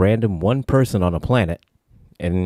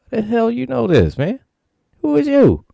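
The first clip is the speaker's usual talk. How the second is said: incredulous; 14 semitones higher than usual. Retold or imitated. Kirk questions the stranger, high and suspicious. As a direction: grill the stranger in disbelief.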